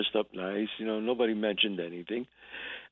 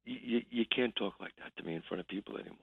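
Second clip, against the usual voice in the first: quiet warning; 4 semitones lower, 11 dB quieter, faster than usual. Retold or imitated. Made friend warns him privately.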